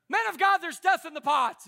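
high, loud hammed-up wail; mock dramatic cry. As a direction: overplay the complaint about the food. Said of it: "Don't go".

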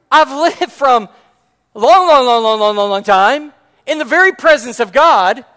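high, very loud incredulous rant; indignant angel shouting. Acted. Angel standing on the table rebukes Zechariah's demand, outraged shouting. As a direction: shout it as an outraged heavenly messenger.

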